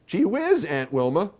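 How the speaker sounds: put-on very high, loud boyish whine; a kid protesting to his aunt; complaining boy. A narrator's reading. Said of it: "I complained".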